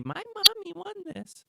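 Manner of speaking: put-on high, slow little kid voice; proud kindergartner showing off; child voice.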